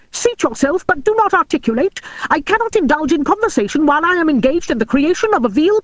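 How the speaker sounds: high, squeaky little dwarf voice; crisp squeaky voice. A narrator's reading. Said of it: "in a crisp, squeaky voice".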